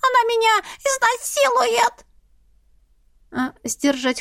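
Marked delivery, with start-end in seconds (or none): 0.0-2.1 very high panicked cat wail. A narrator's reading. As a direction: shriek it like a terrified cat. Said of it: "взвыл кот".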